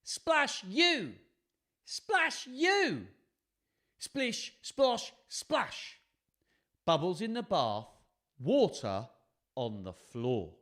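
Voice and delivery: bouncy, rhythmic storytelling with high splashes; lively picture-book narration. A narrator's reading. Reading a bath-time story to children, lively and rhythmic. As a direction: read it sing-song for small children.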